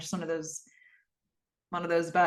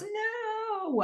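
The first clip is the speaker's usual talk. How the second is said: high, strained cry of no; mock anguished no; 4 dB quieter than usual. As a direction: wail it out in mock despair. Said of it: "Gordon shouts".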